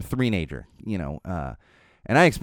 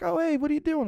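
neighbor's greeting; 3 dB quieter than usual. Retold or imitated. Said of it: "He's like".

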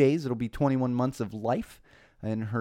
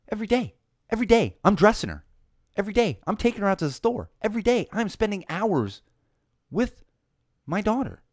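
higher-pitched, exasperated listing; indignant insistence; 10 semitones higher, 4 dB louder than usual. Real, not acted. Dad insisting he cares for his daughter daily, indignant. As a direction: drive home each point, fed up.

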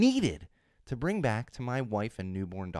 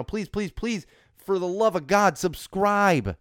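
pleading; 8 semitones higher, 6 dB louder, slower than usual. Real, not acted. Begging listeners to subscribe.

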